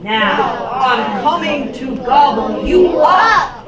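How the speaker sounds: loud, slow, restrained roar; troll's roar. Acted. Troll threatens the big goat, mildly roaring. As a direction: threaten it slowly with volume. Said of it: "roared the troll".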